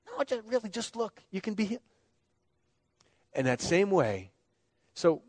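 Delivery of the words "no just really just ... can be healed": much softer, higher, gently begging; soft pleading. Retold or imitated. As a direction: coax the son quietly to just look. Parent pleading softly with son.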